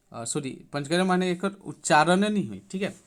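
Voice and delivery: normal talking voice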